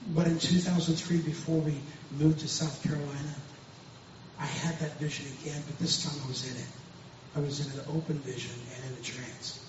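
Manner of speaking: ordinary delivery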